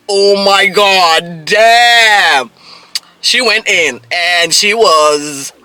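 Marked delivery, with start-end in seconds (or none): 0.1-2.5 loud, drawn-out amazed exclamation; hammed-up shock. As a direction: stretch it out in stunned disbelief. Acting her shocked reaction to rejection.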